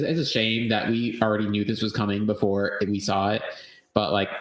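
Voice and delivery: normal talking voice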